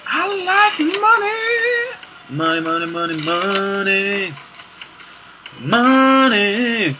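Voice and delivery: very high, slow, drawn-out cry of greed; squeaky gloating about money, stretched out; greedy money voice. Pretend. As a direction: croon over the money like a miser. Gleeful over found gold.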